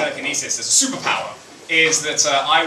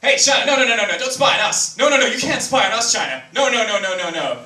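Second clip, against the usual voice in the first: America to China; 5 semitones higher than usual. Retold or imitated.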